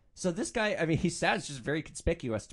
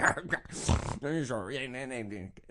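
put-on garbled drunken orc noises; snorts and grunts. Imitating the drunk passenger trying to talk, slurred grunting. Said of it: "snorts and grunts".

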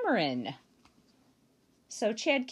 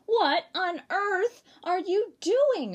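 higher, louder and slow shocked mother; cried out; 9 semitones higher, 6 dB louder, slower than usual. Acted. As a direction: cry it out as a scandalised mum. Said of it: "her mother cried".

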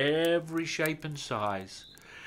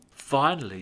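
usual speaking voice

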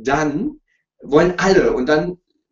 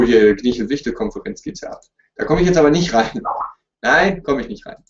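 ordinary talk

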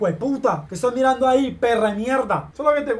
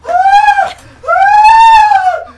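shrill, very loud startled scream; 16 dB louder than usual. Real, not acted. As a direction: shriek in fright. Startled by clip.

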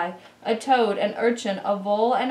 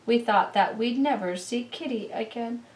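ordinary delivery

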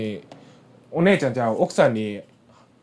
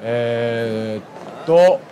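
ordinary talk

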